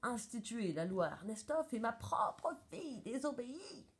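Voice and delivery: indignant judge with swooping pitch; storming. A narrator's reading. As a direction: rage on, dragging out the shame. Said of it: "tempête le juge". (indignation tonnante du juge)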